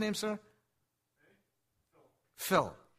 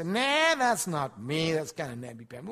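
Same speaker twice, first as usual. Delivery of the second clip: dismissive; 4 semitones higher, 8 dB louder than usual. Retold or imitated. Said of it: "You say".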